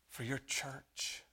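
ordinary talk

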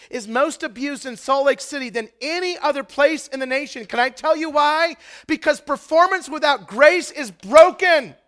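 loud, high, driving sermon voice; fiery pastor pounding home each point to the congregation; preaching at full force. Acted. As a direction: preach it hard from the pulpit, almost shouting.

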